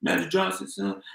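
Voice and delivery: mock high voice; softly retold high-voiced protest; E J/private. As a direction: imitate a man whose voice shot up. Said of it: "voice got high as a bitch".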